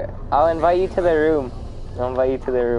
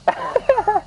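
high-pitched laugh. Real, not acted. Laughing after dying.

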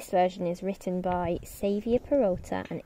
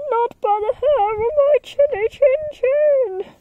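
very high, louder squeal of a scared pig; 6 dB louder than usual. Acted. Second pig refusing the wolf in a high squeal. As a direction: squeal it out in a panic. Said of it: "the second pig squealed".

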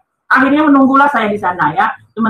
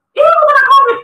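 much higher, emphatic retold diagnosis; emphatic announcement; 13 semitones higher, 4 dB louder than usual. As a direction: announce it pitched way up. Doctor breaks the diagnosis.

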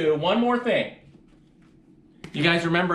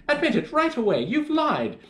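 high-pitched, indignant mother; scolding mother; 7 semitones higher than usual. A narrator's reading. Mother accusing her child of lying, high-pitched scolding. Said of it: "my mother cried".

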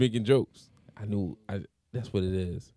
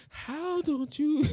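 high, slow mock-sad question; sad whiny imitation; 13 semitones higher, 5 dB quieter, much slower than usual. Host mimics guest's tone about drinking, mock sad. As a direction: mimic him, pitched way up and drawn out. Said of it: "this was literally your tone".

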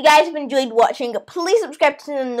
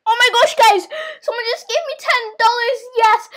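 raised, thrilled exclamation; excited discovery; 8 semitones higher than usual. Acted. Child just given ten dollars tells viewers, excited. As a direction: share the lucky news with delight.

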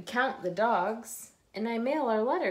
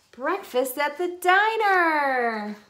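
slow, high and loud, savoring each word; breakfast drawn out with glee; excited arrival; 6 semitones higher, 8 dB louder, slower than usual. A narrator's reading. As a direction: announce the treat with delight. Reaching the diner at last.

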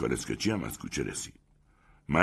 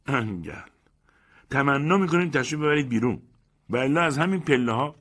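high, louder, scornful voice; mocking, raised voice of a bully; indignant best man; 8 semitones higher, 3 dB louder than usual. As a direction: drive him out with contempt. Best man throws guest out.

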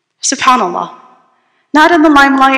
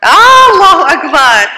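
high, loud and drawn out in wonder; awed exclamation; 7 semitones higher, 6 dB louder than usual. Real, not acted. Preacher to his audience, marvelling aloud at her uniqueness. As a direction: let the praise burst out slowly. Exclaiming awe mid-lecture.

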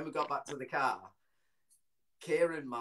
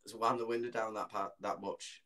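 ordinary delivery

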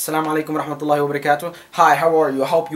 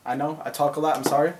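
usual speaking voice

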